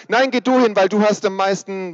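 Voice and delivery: put-on very high, loud whiny child; insist, almost shrieking; squabbling kid. Kinder streiten, wer hingeht. (quengelndes Kind, sehr hoch und laut)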